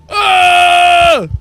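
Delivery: normal talking voice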